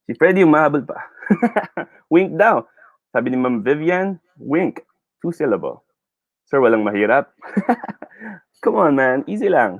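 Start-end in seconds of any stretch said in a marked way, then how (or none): 1.0-1.8 short, high-pitched chuckle; a quick burst of real laughter; amused laugh. Real, not acted. Amused by a late answer. 7.4-8.3 high, short giggle; a quick real chuckle between remarks; amused laugh. Real, not acted. Joking about easy quiz.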